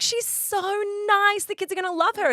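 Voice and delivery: high sweet enthusing; gushing self. Retold or imitated. Speaker praising the babysitter to her husband, high gushing. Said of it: "I was like".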